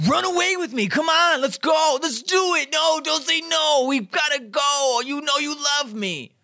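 badgering plea in a high, strained imitation; frantic mock yelling. Jess pressuring Rory to run away, frantic mock yelling. Imitating his frantic demand, against gentle version.

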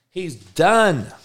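high, strained outburst; exasperated rant. Real, not acted. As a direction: push it up high, fed up. Keeps ranting about the player.

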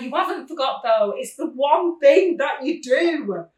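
half-loud scolding, slowing at the end; mother reliving telling off her son, mock angry; retold telling-off. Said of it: "I was going absolutely sick at him".

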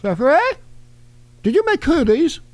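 high, halting put-on voice; mock caller. Host as a caller asking after Jeffrey, high halting impression. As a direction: stammer in a high comic voice.

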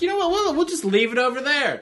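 high, airy brush-off; mock dismissive. Dismissing the Raw episode everyone hated, high and mocking. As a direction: wave it away in a high mocking voice.

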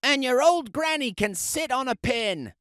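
the pig taunting the wolf about granny; pig yelling. Acted. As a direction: taunt like a nasty little pig. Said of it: "the third little pig yelled".

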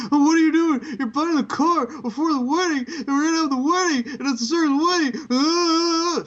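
high, loud whiny mock voice; shrill mock whining about a car; mocking Lana. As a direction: whine it like a nagging fiancée. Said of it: "and you're like".

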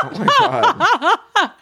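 slow, high laughing oh my God; drawn out through laughter. Real, not acted. Laughing, stretched out.

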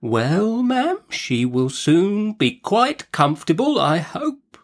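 slow, high, timid voice; careful, meek little man; meek doctor. A narrator's reading. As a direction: answer hesitantly and placatingly. Voices meek Mister Chillip.

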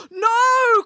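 long, high cry of dismay; anguished high shout; horrified cry. A narrator's reading. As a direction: cry out as a friend is lost. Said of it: "cried Loretta".

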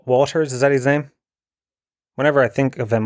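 usual speaking voice

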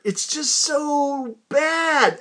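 slow, high, disgusted groan; disgusted drawl. Real, not acted. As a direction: drag it out in disgust. Complaining about sour yogurt.

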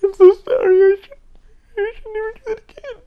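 high, loud whimpering mock apology, half laughing; mock groveling apology. Mocking how critics want him to grovel in a corner. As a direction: ham up a snivelling apology. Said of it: "I should fucking sit there in a corner and go".

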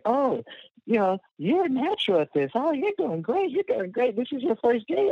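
high, gushing praise from a dance teacher; dance teacher gushing over a natural, high and delighted. Retold or imitated. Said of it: "the teacher's like".

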